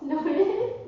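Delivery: laughing through the words; cracking up mid-sentence. Real, not acted. Breaks into laughter on retake.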